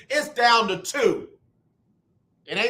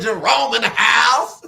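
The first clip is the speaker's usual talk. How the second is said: louder, laughing hype call; hype announcing; 7 dB louder than usual. Retold or imitated. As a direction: announce him like a star, laughing. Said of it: "I said".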